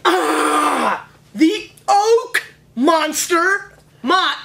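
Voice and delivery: loud, high, drawn-out playful holler; mock monster alarm. Host hollers about the oak monster to Mott, mock alarm. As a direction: holler it big, stretching the words.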